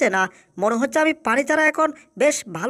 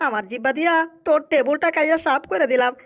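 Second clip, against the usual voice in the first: ordinary delivery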